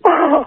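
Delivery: high, drawn-out cooing "oh"; exclaimed longing oh. Retold or imitated. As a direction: swoon out a high little moan. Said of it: "I go".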